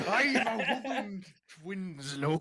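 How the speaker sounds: put-on slow, laughing old sailor voice; Willem Dafoe voice. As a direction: growl it like a crazy old sailor. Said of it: "Willem Dafoe in The Lighthouse".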